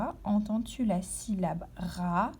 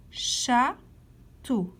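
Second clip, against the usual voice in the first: "Château" split in two with a clear pause; syllable by syllable; 4 dB louder than usual. Shown as a demonstration. Teacher letting pupils locate a sound, "Château" syllable by syllable. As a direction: stretch out "Château", pausing between its two syllables. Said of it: "Colorie la case où tu entends to".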